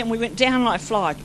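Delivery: ordinary talk, nothing marked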